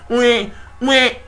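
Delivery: high, soft fake baby wail; mock crying. Vlogger imitating Lambo crying, mock bawling. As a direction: imitate a kid bawling, hammed-up. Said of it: "he starts bawling".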